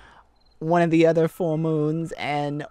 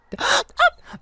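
high startled gasp; sharp gasp; 6 dB louder than usual. Acted. Caught by her own hint, sharp gasp. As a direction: gasp as it dawns on you.

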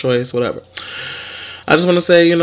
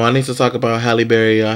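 ordinary talk

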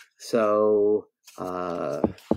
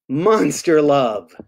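slow, drawn-out refrain; 5 dB louder, much slower than usual. Acted. Reader closes each verse for children, slow drawn-out refrain. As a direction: stretch each word of the refrain.

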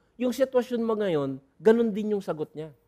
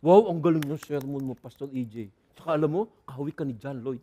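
flattering churchgoer; 7 semitones lower, 3 dB quieter than usual. Retold or imitated. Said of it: "hindi namin hinahanap yung".

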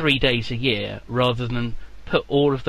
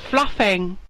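higher fake Chinese accent; a clipped put-on Chinese accent; 6 semitones higher than usual. Pretend farmer names his job, fake Chinese accent. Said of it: "I can't do the accent".

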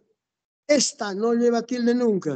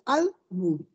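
"álbum" drawn out slowly, stress on AL; stress on first syllable; 3 semitones lower, 5 dB quieter than usual. Shown as a demonstration. Teacher giving the correct word, slow "álbum" stressed on AL. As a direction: say "álbum" slowly as AL, then bum. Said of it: "tenía que estar en la A".

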